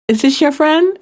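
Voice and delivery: TikTok sound imitation. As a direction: lift it up playfully, like quoting a TikTok audio. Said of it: "Like that TikTok sound".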